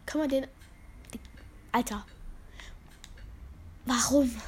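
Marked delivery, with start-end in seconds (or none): 1.7-4.4 whispering. Real, not acted. Frustrated after dying in game.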